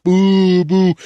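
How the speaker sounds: loud high boos; loud mocking boos; crowd booing. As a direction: boo like a restless crowd.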